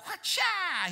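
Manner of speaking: high loud karate cry; a mock martial-arts shout; ninja yell. Preacher mimes Peter's sword strike with a high karate cry.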